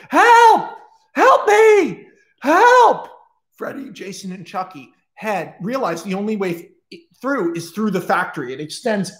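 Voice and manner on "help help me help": high, loud desperate cries; screaming for help. Acted. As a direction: scream it from far inside. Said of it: "they can hear someone screaming".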